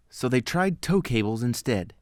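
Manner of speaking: ordinary delivery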